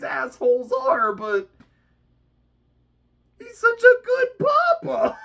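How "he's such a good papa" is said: high, loud delighted squeal; gushing squeal. Real, not acted. Vlogger gushing over a cute villain family, squealing. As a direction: squeal it like it's adorable.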